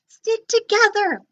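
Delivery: high loud urgent call; rally your brother. Acted. Bork urging Gork on, high and loud.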